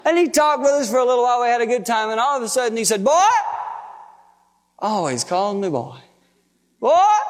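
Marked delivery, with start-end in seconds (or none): 3.0-3.3 sudden high, loud call; high-pitched holler to get attention; calling out sharply. Acted. Grandpa abruptly calls grandson. 6.8-7.2 high, loud one-word summons; grandpa's high, insistent call; calling out sharply. Acted. Grandpa calls grandson again.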